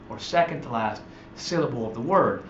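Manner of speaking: ordinary talk, nothing marked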